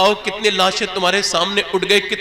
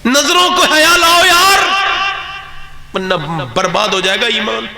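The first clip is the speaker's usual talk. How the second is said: high, loud pleading cry; fiery exhortation; 9 semitones higher, 8 dB louder than usual. Real, not acted. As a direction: shout it at the crowd. Preacher exhorts audience.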